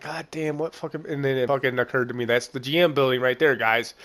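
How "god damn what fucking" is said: softer, puzzled mutter under the breath; muttering to himself. Retold or imitated. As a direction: mumble it quietly, squinting at the building.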